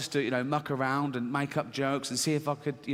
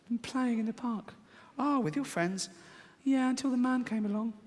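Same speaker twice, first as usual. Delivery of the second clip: put-on high childish girl voice; a small daughter piping up high; little girl voice; 9 semitones higher, 3 dB quieter than usual. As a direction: play the six-year-old chirping to her dad. Comic voices six-year-old girl.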